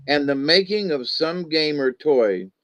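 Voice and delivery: plain reading voice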